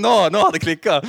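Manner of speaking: blurted out loud and high through laughter; laughing quote. Real, not acted. Preacher imagining his own reaction, laughing loudly. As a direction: say it while still laughing hard.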